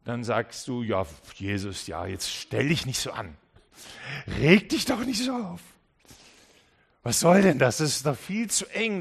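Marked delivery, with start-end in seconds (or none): 0.8-3.4 low, offhand brush-off; lightly voiced listener waving off Jesus; dismissive. 4.4-9.0 louder, irritated push-back; a listener irritated by the warning; annoyed retort. Pretend. Listener brushing off Jesus.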